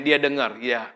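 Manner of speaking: ordinary talk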